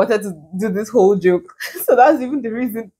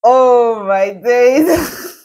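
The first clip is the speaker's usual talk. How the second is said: cringing; 5 semitones higher, 6 dB louder, much slower than usual. Real, not acted. Cringing at her old favourite song.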